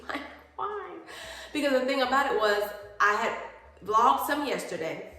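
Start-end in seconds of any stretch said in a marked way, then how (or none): 0.1-0.9 laughing exasperation. Real, not acted. Exasperated at broken phone.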